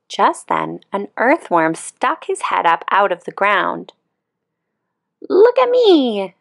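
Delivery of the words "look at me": high, louder show-off beetle voice; a young beetle bragging to the world; proud little beetle. Acted. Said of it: "said the young click beetle".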